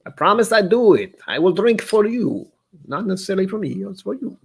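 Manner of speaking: high, hammed-up Italian Mario accent; mock Mario accent. Vlogger promising viewers he'll drink, in a mock Italian Mario accent. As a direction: do a cartoonish Italian plumber. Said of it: "I don't know what that accent was. It's like Mario".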